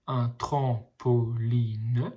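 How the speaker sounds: very slow, split into syllables; slowly sounding out each syllable. Shown as a demonstration. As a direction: sound out tram-po-line for a child. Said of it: "les petits ponts vous aident à lire".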